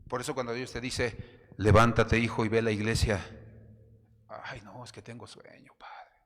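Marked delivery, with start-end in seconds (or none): 1.6-3.2 booming, much louder command; a divine voice thundering to get up; God's loud call. Acted. God calling the sleeper. 4.3-6.2 whispered, drowsy whine; a lazy believer whispering excuses to God; sleepy excuse. Acted.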